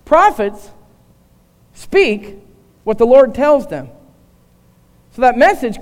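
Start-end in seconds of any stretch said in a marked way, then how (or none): none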